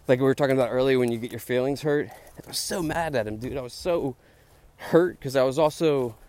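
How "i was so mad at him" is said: ordinary talk, nothing marked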